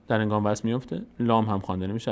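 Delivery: usual speaking voice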